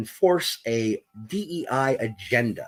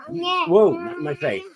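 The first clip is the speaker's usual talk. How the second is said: startled protest. Real, not acted. Something pushed in his face.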